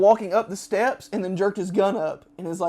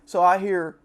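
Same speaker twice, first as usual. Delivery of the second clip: ordinary talk, nothing marked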